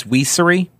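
"Weesery" starting with a wee sound; jokey "Weesery", first syllable like "we"; mock-formal Weesery. Shown as a demonstration. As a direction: say "Weesery" as WEE-suh-ree. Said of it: "If we're being formal".

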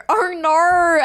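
high, loud, broad Australian "Oh no."; exaggerated Australian "Oh no" as she drops things; broad Aussie oh no. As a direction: squeal "Oh no." in a broad Australian twang. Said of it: "that sounded so that".

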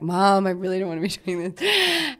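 lightly voiced teenager pleading with mom; mock teen complaint; reluctant teen daughter. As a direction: imitate a reluctant daughter, breaking into a laugh. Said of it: "thirteen or fourteen-year-old daughter".

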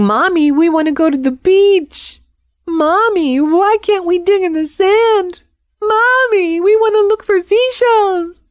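very high, mock-crying child voice; whining kids. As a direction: imitate little kids wailing to their mother. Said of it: "all those kids that were crying".

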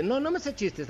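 lightly voiced virtual assistant; a hint of a polite robotic assistant; Siri voice. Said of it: "imagínate que te pongan a manejar a Siri". (voz de asistente virtual)

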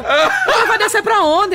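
high, loud, slow, laughing question; baffled high question. Retold or imitated. Boyfriend confused by assanhada, asking high and baffled. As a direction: ask where she's going, puzzled.

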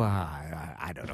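ordinary delivery

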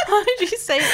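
high-pitched, helpless laugh through the words. Real, not acted. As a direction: crack up while asking. Co-host cracking up at the story.

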